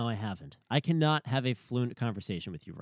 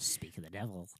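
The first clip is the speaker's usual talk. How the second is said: whispering; 7 semitones lower, 10 dB quieter than usual. Real, not acted. Hushed aside after the teasing.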